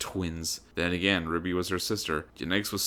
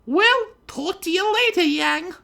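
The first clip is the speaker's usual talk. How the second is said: haughty heiress greeting; 7 dB louder than usual. A narrator's reading. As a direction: greet her rival from on high. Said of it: "departed with a smirk".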